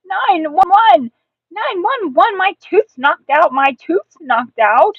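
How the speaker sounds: high, loud emergency cry; panicked child's holler; frantic calling for help. Acted. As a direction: shout it out the door.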